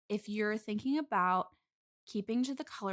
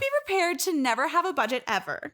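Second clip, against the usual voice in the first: resentful; 5 semitones higher, 5 dB louder than usual. Real, not acted. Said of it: "I'm hearing a little bit of resentment".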